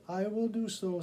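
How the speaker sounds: a tired mother giving in crossly; exasperated woman. Acted. Exasperated woman answers cat.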